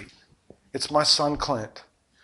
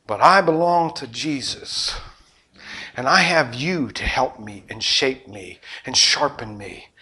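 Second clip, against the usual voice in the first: ordinary delivery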